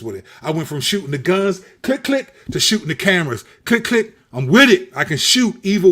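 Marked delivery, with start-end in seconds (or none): 1.8-2.3 high, playful click click; mimicked shutter sound up high; camera clicks. 3.6-4.1 high, playful click click; mimicked shutter sound up high; camera clicks.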